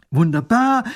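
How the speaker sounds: loud, high cry of joy; delighted. A narrator's reading. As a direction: exclaim it as if hopping with joy. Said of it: "freut sich der Großherzog".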